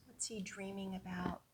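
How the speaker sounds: quick hushed whisper; don't wake the sleeping boy. A narrator's reading.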